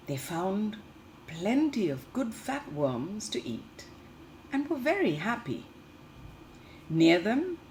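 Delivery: lively storybook reading for little ones; reading to children. Acted. Teacher reading a picture book to the class, lively storytelling. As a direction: read the tale brightly to the class.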